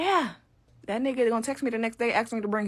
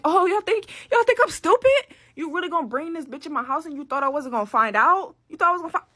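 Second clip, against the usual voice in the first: much higher and louder, outraged; furious yell; 7 semitones higher, 6 dB louder than usual. Retold or imitated. Girlfriend catching boyfriend with another girl, furious shouting. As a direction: scream at him for bringing her home. Confronting cheating boyfriend, yelling.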